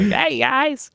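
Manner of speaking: very high-pitched put-on impression voice; high mimicking voice; Prime. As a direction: pitch it way up into a caricature greeting. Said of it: "that's a good impression".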